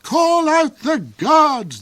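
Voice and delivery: high, loud, slow barked order; repeated bark. Acted. The Duke repeats his order to Hark, loud and high. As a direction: bark it out again with force. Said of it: "the Duke repeated".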